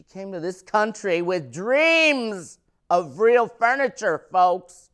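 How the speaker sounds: dramatic build. Acted. Comic telling the crowd about mom's dreams, loud dramatic build. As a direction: swell it up like a grand tale. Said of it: "comic building to a punchline".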